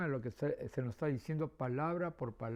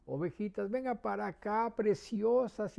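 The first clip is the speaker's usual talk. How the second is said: lightly voiced coaxing woman, higher and slowing on preciosas; sweet-talking the sheep; 7 semitones higher, 5 dB louder than usual. A woman tries to lure the sheep, mild coaxing voice. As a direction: coax the sheep like a sweet lady. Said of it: "Y a una mujer le dicen".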